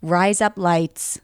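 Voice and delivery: slow American "Rise up lights"; American accent. Shown as a demonstration. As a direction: put on American vowels, evenly drawn out. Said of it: "do it in an American accent".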